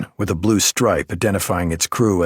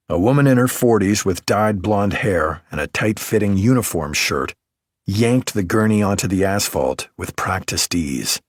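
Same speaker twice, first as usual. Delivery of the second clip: ordinary delivery, nothing marked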